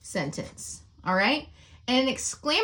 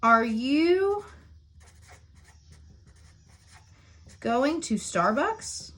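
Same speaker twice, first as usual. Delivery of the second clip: slow dictation; 3 semitones higher, much slower than usual. Shown as a demonstration. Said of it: "our example for inter-, interrogative sentence is".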